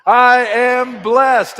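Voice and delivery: high, loud and slow shout. Shown as a demonstration. Pastor leading the congregation in a joyful shout. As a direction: shout it from the mountaintops. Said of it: "shouting from the mountaintops".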